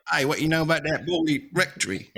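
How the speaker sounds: high, chummy put-on voice; mock casual question. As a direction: play the friend casually asking a mate. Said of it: "Just be like".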